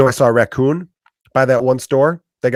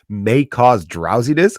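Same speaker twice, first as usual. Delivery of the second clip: slow, drawn-out drowsiness, lingering on each word; savoring the word; slower than usual. Shown as a demonstration. Comic savoring the label words, slow and drawn out. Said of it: "I get excited reading that".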